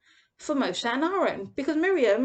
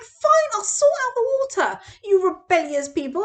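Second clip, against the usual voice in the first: much higher and louder, a furious snap at the people; angry retort; 7 semitones higher, 6 dB louder than usual. Retold or imitated. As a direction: storm out and lash at the rebels. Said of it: "he comes storming out".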